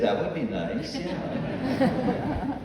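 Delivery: teasing, laughing through the words; the wife gently teases with a laugh. Retold or imitated. Wife teases her husband, laughing as she answers. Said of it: "And she said".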